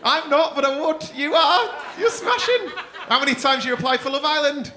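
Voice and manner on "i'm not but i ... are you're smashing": higher, gushing compliment; camp flirty gush. Pretend. Comedian flirting with a crowd member, camp gush. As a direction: gush over him playfully.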